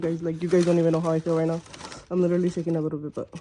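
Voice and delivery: ordinary delivery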